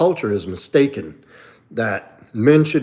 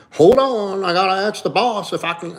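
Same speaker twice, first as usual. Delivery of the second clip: higher, mocking a man checking with his wife; mocking timid husband; 8 semitones higher than usual. Mocking a friend who must ask the boss first, higher and timid. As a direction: sneer it out like a nervous husband. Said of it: "And they're like".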